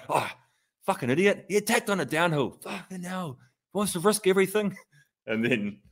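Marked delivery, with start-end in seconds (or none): 0.1-4.8 mock exasperated partner. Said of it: "she was just like".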